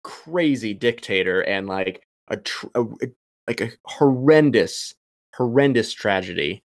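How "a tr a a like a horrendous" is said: ordinary talk, nothing marked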